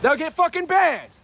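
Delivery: loud high shout at chat. Real, not acted. Hyping his chat to follow.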